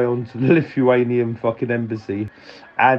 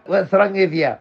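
a higher mock mum voice; nagging mum; 7 semitones higher than usual. Mum told off her son for skipping play, mock nagging voice. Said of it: "She sort of went".

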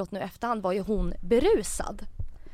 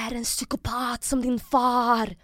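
slow, mild mock yell; half-voiced imitation of an angry scream; imitated yell; 3 semitones higher, slower than usual. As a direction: draw out the accusation as a retold scream. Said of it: "Hon skriker".

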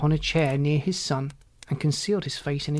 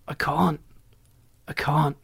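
understated, despairing moan. A narrator's reading. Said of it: "he moaned".

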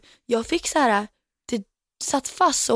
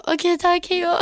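brisk brush-off; 8 semitones higher than usual. Retold or imitated. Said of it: "Och jag bara".

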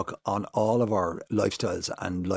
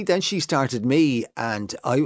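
ordinary talk

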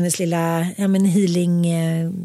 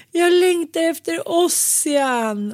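higher and slow, close to tears; tearful longing; 7 semitones higher, slower than usual. Retold or imitated. Crying on the healer's table, she tells the healer she misses Ossian. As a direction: let it come out with fresh tears. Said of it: "kommer med ny gråtattack".

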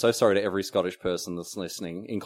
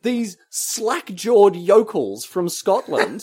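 high, louder indignant rant; mock outrage; 11 semitones higher, 4 dB louder, slower than usual. Real, not acted. Telling how Scots teased him for posh, high indignant. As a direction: complain in high disbelief.

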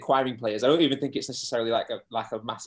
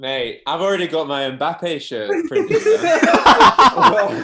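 laughing; 5 semitones higher, 10 dB louder, much slower than usual. Real, not acted. Joking about Mbappe transfer hopes.

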